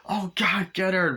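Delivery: high, urgent acted order; mock-frantic calling; urgent chase call. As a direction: send the others after her. Acts out pursuers calling out.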